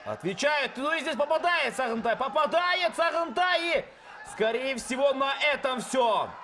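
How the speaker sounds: high, loud excited play-by-play; excited shout. Real, not acted. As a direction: yell the knockout call. Knockout finish called live.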